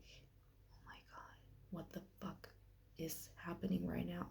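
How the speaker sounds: hushed, breathless disbelief under the breath; stunned whisper to herself; shocked whisper. Retold or imitated. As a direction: whisper it like you can't believe what you're hearing. Shocked inner reaction in hospital.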